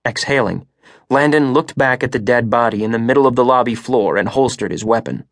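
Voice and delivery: ordinary delivery, nothing marked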